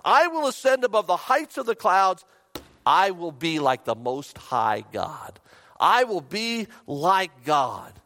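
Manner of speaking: loud declaration, slowing to a grand finish; swelling, prideful boast; self-willed boast. Retold or imitated. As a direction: proclaim yourself supreme, drawing out the last words. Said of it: "Self-will".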